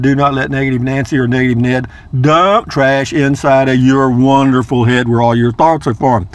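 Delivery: ordinary talk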